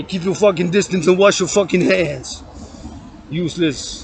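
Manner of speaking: ordinary delivery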